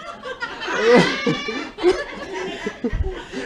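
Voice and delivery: a high burst of real laughter; bright, high cackle of laughter. Real, not acted. Laughing at being teased.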